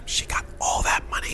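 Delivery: soft breathy whisper. Real, not acted. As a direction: whisper it like a secret. Whispered aside.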